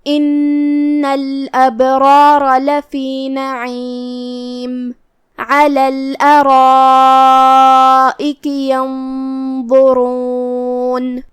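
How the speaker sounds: very slow, long held notes in tajweed chant; teacher's slow melodic recitation; Quran recitation. Shown as a demonstration. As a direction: intone the verse, stretching every vowel. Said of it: "کلمت علی کی شروعات میں".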